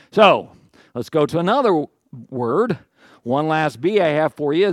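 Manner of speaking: ordinary talk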